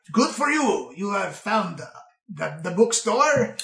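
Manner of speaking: put-on swooping, accented old shopkeeper voice; drawn-out old bookseller; gnarled old bookkeeper. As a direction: play a quirky ancient gnome. Game master plays old bookkeeper.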